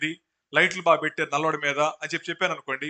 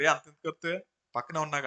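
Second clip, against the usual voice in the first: husband's reply; 7 dB quieter than usual. Acted. Husband answering wife beside him, in an undertone. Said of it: "భర్త ఏమంటాడు".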